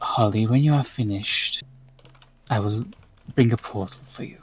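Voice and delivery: soft, weary goddess after a sigh; quiet, resigned, with pauses between phrases; Raven Queen. Acted. Raven Queen sighs and promises Holly a portal, softly resigned. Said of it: "Raven Queen just sighs and just goes".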